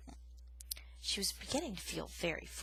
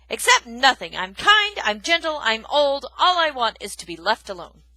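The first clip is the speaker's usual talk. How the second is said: loud, high indignant declaration; angry insistence; 4 semitones higher, 14 dB louder than usual. Acted. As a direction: insist hotly. Aerith angrily insists.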